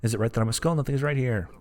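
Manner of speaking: ordinary talk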